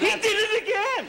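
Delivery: shrieking high, loud outburst; exasperated shout. Acted. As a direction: scream it in comic exasperation. Harvey explodes at Sonny again.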